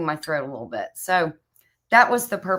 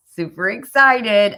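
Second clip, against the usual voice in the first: excited catchphrase; 4 semitones higher, 7 dB louder than usual. Real, not acted. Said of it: "super excited is my catchphrase".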